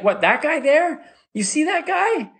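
put-on high, slow incredulous kid voice; mock young boy. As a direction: question it in a high boyish pitch. Kid's retold reply to security.

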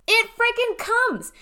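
sudden high, loud, slow burst of excitement; excited declaration. Real, not acted. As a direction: land it like a big reveal to the listeners. Building hype about manifesting.

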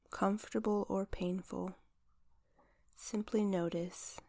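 soft, unhurried meditation voice; guided visualization. Acted. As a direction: guide them gently through the body.

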